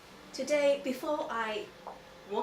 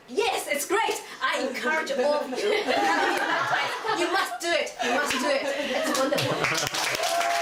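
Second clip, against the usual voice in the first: loud, laughing encouragement; excited urging; 8 dB louder than usual. Real, not acted. Urging the congregation to get baptized, loud laughing excitement. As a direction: cheer them on, laughing.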